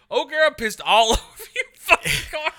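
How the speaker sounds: high, loud, laughing outburst; laughing blame. Real, not acted. Friend shifts the blame onto O'Gara, laughing loud and high. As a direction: blame him while cracking up.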